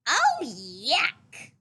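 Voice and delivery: put-on loud disgusted shriek, child voice. A narrator's reading. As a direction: squeal it out in disgust. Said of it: "shrieked Chester".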